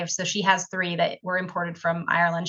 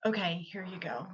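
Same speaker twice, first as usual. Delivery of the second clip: much softer, laughing through it; laughing handover; 12 dB quieter than usual. Retold or imitated. Gym clerk handing Cindy the bottle, soft laugh.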